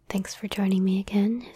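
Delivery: ordinary talk, nothing marked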